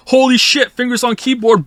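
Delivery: frantic, high and loud; hammed-up panic over a deadline; panicked. Panischer Autor gespielt.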